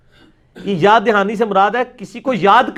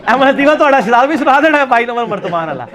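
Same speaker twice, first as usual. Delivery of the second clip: laughing; 4 semitones higher, 4 dB louder than usual. Real, not acted. Mocking jibe at opponents.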